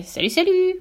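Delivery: high parrot voice climbing at the end; high, rising bird greeting; parrot call. A narrator's reading. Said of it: "lui lança le perroquet".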